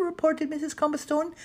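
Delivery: usual speaking voice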